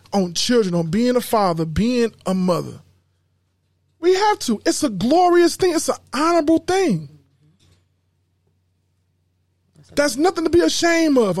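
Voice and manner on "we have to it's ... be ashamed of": preaching. Real, not acted. Preaching about family.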